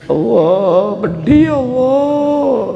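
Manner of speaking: high, drawn-out mock cry of pain; stinging-eyes wail. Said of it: "Taruh gini kan lama-lama pedih". (pura-pura meraung kepedihan)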